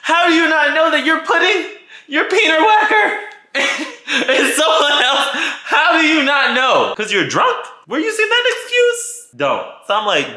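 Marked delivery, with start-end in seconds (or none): 0.0-9.3 high, loud, laughing outrage; outraged disbelief. Retold or imitated. Confronting the drunk cheater, high laughing outrage. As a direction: hurl the questions up high, half laughing. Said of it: "I'm over here like".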